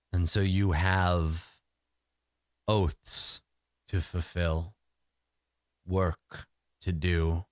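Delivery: low, slow, solemn spirit; a patient old god laying out duty; deep resonant voice. Acted. The deer spirit speaks again.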